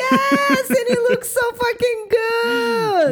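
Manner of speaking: high, loud gushing praise; excited. Real, not acted. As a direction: shout the compliment with delight. Gushing over friend's nose piercing.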